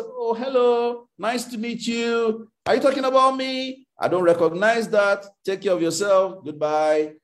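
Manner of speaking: cheerful dismissive hello; mock polite brush-off. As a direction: wave it away sweetly, like meeting a stranger.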